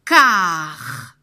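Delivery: "Car" loud and drawn out; syllable sounded out. Shown as a demonstration. Teacher isolating a syllable to spell it, "Car" sounded out loudly. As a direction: hit "Car" hard and stretch it. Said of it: "il va falloir bien écouter".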